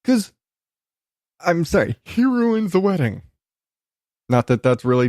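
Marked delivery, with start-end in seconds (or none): none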